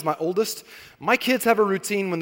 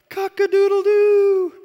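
loud, high, slow mock crow; child imitating a rooster, loud and high; 7 dB louder, slower than usual. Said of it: "the rooster gets up and goes".

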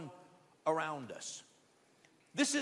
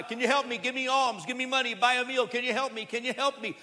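louder, higher pleading calls; beggar calling out; 5 semitones higher, 4 dB louder, faster than usual. Retold or imitated. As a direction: cry out to every passerby for help. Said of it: "People are walking by".

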